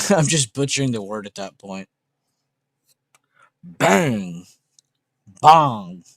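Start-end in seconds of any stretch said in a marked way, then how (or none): 3.6-4.4 a sharp, louder "Bang!"; mock loud bang. Shown as a demonstration. Mocking the French sound to the chat with a loud "Bang!". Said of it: "It's like a huge difference". 5.4-6.0 a much louder, booming "Bong!". Shown as a demonstration. Mocking the French sound to the chat, booming "Bong!". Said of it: "It's like a huge difference".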